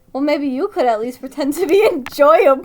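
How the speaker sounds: loud, laughing snarky comeback; loud bratty line through laughter; laughing retort. Acted. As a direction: fire it back while cracking up. Reading Tommy's retort, laughing.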